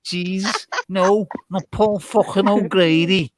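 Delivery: high-pitched Liverpool accent, mock exasperated; hammed-up Liverpudlian indignation; exaggerated Scouse. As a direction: protest in broad Scouse. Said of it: "You sound like Paul O'Grady".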